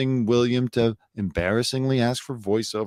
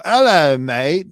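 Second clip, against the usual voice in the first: loud, higher mock British greeting; big playful British hello; British accent; 6 semitones higher, 9 dB louder, slower than usual. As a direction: hail a pal in put-on British. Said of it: "have a British friend that you see and you go".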